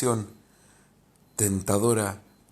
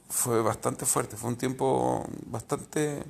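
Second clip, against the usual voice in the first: ordinary talk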